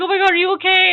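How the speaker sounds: high, loud show of alarm; exaggerated worried gasp of words; mock babying concern. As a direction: imitate a sister fussing over you. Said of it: "she's vaping me".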